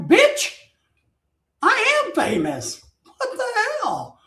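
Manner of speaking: high-pitched mock outrage; hammed-up indignation; indignant retort. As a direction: protest as if insulted, pitch jumping up. Acting out indignant retort to nurse.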